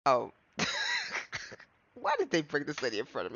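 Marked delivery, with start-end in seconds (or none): 0.6-1.4 high squealing burst of laughter; shrieking laugh. Real, not acted. Reacts to Von Karma appearing.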